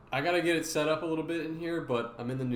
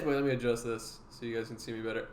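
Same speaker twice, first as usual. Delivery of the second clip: usual speaking voice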